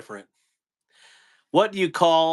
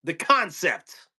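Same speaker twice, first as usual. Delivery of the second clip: doing Walken's tooth fairy speech, pitched up; Christopher Walken impression; 8 semitones higher than usual. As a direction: lean into the odd Walken pauses. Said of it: "And he's like".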